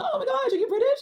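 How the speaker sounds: high-pitched gushing question; hammed-up surprise; mock amazement. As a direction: play an over-excited, clueless questioner. Acts herself asking an Australian.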